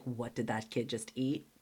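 worried thought kept to herself; hushed inner freak-out; inner panic. Retold or imitated. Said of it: "totally internally freaking out".

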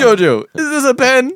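loud, high-pitched mock cry; crazed nurse character. Possessed nurse asking Jojo about a pen, hammed-up cry. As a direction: pitch it up into a manic question.

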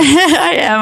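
laughing and smiling; bright laughing reply. Real, not acted. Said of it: "I'm smiling".

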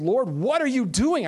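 high, loud anguished cry to the Lord. Retold or imitated. Weeping at the Western Wall, he cries out to the Lord loud. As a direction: call out to God, overwhelmed and pleading. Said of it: "I said it out loud".

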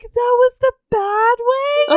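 high, slow incredulous reply; bad acting. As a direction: ask it in tender disbelief. Heroine's reply voiced.

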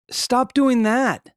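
slow, high-pitched reprimand; exasperated scolding. Acted. Owner to dogs eating moss, exasperated scolding. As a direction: drag out the command at a naughty dog.

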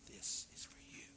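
a whispered threat from mama; menacing whisper. Retold or imitated. Mama at the plum tree warning her son, whispered threat. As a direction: breathe it out, soft and ominous. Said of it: "and she'd say".

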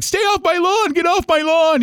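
shrill high crone voice yelling off my lawn; cranky old neighbor. Retold or imitated. Crusty neighbor lady shooing kids off her lawn, high squawk. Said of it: "she'd be like".

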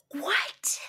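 high, slow amazed disbelief; mock stunned at the coincidence; retelling her own shock, high and drawn out. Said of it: "I was like".

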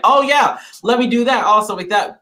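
hammed-up bandwagon enthusiasm; mock eagerness. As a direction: jump in like an overkeen newcomer. Mimics eager newcomers copying stepping.

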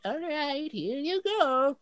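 put-on high, slow old lady voice; very old woman. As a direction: slowly hand it over like a frail granny. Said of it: "very old lady".

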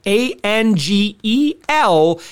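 slow letter-by-letter spelling; spelled out slowly. Acted. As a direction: spell each letter out deliberately. Spelling out the promo code.

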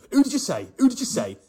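loud, high, bullying repeat; Finchy chewing him out. Retold or imitated. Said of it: "Finchy's chewing him out".

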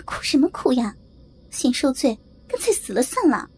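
exasperated scolding building to a high-pitched, fast outburst; irritated yelling. A narrator's reading. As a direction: start annoyed, end yelling at the crier. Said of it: "冲着她嚷道". (不耐烦地嚷嚷，越嚷越尖)

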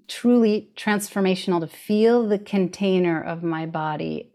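ordinary talk, nothing marked

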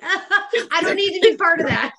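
high and loud through real laughter; laughing. Real, not acted. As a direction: squeeze the words out mid-laugh. Laughing at own hang-up story.

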